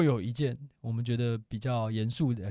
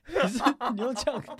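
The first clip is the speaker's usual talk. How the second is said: laughing; 13 semitones higher than usual. Real, not acted. Teasing friend who choked.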